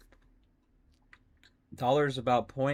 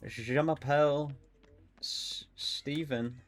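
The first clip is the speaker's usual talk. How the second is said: mock French; 3 semitones higher, slower than usual. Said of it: "But I am bilingual".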